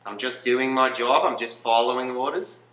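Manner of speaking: matter-of-fact mock Australian accent; Australian Nazi. Nazi excusing himself as following orders, mock Australian accent. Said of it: "You're an Australian Nazi".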